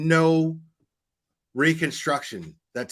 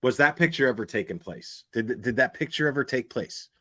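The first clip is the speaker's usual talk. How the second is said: normal talking voice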